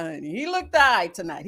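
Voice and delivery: ordinary talk, nothing marked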